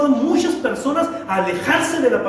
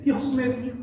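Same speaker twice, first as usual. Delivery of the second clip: softer, a hint of tearful pious murmur; mock tearful pious voice; 6 dB quieter than usual. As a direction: lightly imitate a teary believer. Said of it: "Y te lo dicen con lágrimas".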